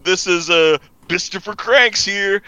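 high, loud show intro; mock podcast host. Friend plays the replacement clone opening the show, mock host. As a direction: announce yourself like an over-eager host.

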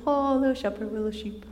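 soft, cooing to little sheep; tender shepherd voice. Retold or imitated. Preacher voicing a gentle shepherd, softly cooing.

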